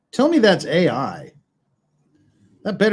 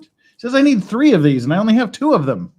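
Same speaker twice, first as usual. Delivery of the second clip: frustrated; 6 semitones higher, 7 dB louder, faster than usual. Real, not acted. Frustrated at missing pieces.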